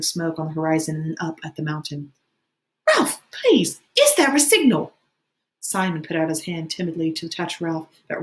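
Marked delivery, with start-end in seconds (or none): 2.9-4.9 high, loud desperate question; high-pitched frantic plea; urgent pleading. A narrator's reading. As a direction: beg for an answer, voice pitched way up. Piggy begs Ralph for an answer.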